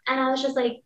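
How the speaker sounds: normal talking voice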